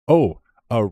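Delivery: usual speaking voice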